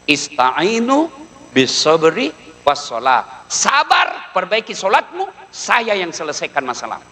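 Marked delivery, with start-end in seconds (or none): 0.1-3.4 drawn-out Quranic verse, slow; slow Arabic recitation. Acted. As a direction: recite the verse slowly and reverently. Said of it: "ini pegang".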